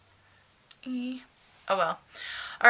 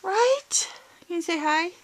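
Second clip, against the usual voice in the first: high cat talk; 10 semitones higher, 6 dB louder than usual. Real, not acted. Cooing to the cat.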